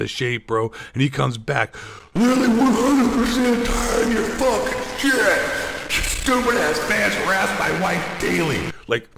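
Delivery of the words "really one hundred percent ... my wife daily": high, slow whiny imitation of the angry writer; mock the message in a squeaky voice; mocking angry reply. Reading Drifter's angry message.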